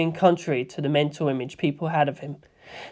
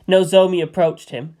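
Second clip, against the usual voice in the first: ordinary delivery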